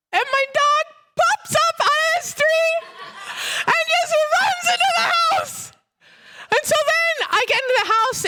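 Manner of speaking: very high, laughing squeal of disbelief; astonished excitement. Retold or imitated. Telling the church the dead dog jumped up, amazed. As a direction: squeal the punchline through laughter.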